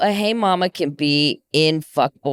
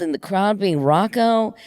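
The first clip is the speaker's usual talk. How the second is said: usual speaking voice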